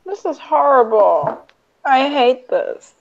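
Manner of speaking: slow, unhappy whine; dismayed complaint. Pretend. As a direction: draw it out, thoroughly miserable. Player dismayed by the bloody scene.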